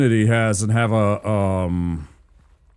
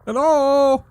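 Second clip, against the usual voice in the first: voice mod; 4 dB louder than usual. Shown as a demonstration. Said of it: "that was me with my voice mod on".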